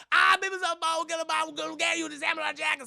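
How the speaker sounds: hammed-up yokel hollering in a run-on jumble; pig sheriff. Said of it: "his voice kills me".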